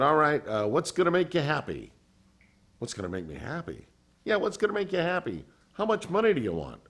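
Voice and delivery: imitating an eager, generous manager; boss's offer.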